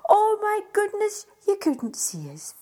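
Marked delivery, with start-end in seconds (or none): 0.1-1.3 loud exclaim. A narrator's reading. Storyteller exclaims at covered face.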